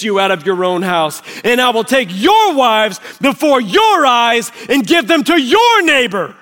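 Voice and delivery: loud, high, thundering scripture reading; preaching at full force. Acted. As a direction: thunder the Lord's rebuke, hammering key words.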